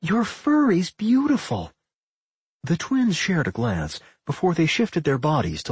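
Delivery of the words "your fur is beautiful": high, drawn-out admiration; exclaiming. A narrator's reading. Millie admires the pups' fur, gushing. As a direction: gush slowly, pitched high. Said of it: "Millie exclaimed".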